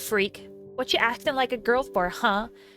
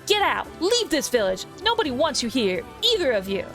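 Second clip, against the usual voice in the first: shouting bully; 5 semitones higher, 5 dB louder than usual. Acted. Bully yells at Kaine.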